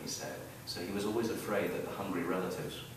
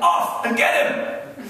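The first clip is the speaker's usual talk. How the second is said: high, drawn-out battle yell; 13 dB louder, much slower than usual. A narrator's reading. Little girls attacking the wolf together, yelling. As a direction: yell it slowly at high pitch, full force.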